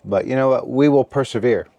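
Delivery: usual speaking voice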